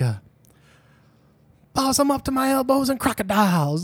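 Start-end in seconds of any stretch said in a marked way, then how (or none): 1.7-3.8 mock a bad Hollywood Southern accent; movie Southern accent. Podcaster mocking a film investigator's line, hammed-up Southern accent. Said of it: "he says something in the movie like".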